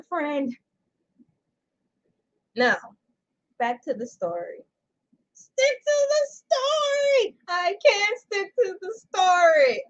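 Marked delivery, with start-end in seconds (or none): 5.3-9.8 high, slow, whiny voice; whiny self-scolding. Pretend. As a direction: whine it at yourself. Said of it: "I'm freestyling".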